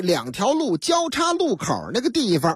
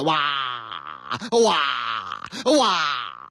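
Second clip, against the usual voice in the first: 拖长的“哇——”假哭声; 学孩子一声声拉长地哭; 模仿婴儿哭; 11 semitones lower, much slower than usual. Retold or imitated. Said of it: "再看那孩子哭的". (低沉夸张的模仿啼哭)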